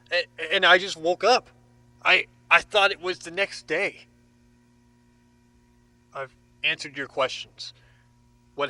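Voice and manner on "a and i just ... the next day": nervous stammer. Acted. Stammering under questioning.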